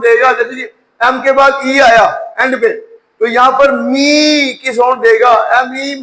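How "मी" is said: "मी" with the vowel held long, mee; long E sound. Shown as a demonstration. Teacher showing E at word end, "मी" drawn out. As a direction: stretch "मी" out slowly to show long E. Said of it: "ई long sound देगा".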